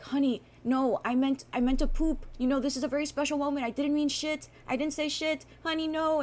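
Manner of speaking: high, frantic mock pleading; pleading boyfriend. Boyfriend begs upset girlfriend to stay, high and frantic.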